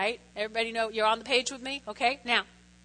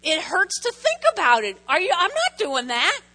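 mock protest; 6 semitones higher, 5 dB louder than usual. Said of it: "And I'm like".

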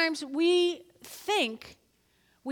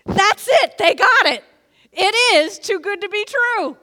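high, much louder exclamation; excited shout; 7 semitones higher, 10 dB louder than usual. Acted. Pastor exulting over the doubter's words, high and loud. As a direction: burst out with joy.

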